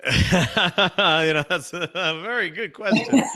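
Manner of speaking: real laugh breaking into the reply. Real, not acted. Speaker amused by the host's question, laughing.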